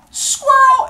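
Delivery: very high, loud yelp; sudden distracted shout. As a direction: yell it as an abrupt diversion. God shouts a distraction, runs off.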